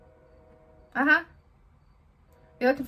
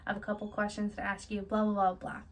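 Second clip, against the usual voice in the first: normal talking voice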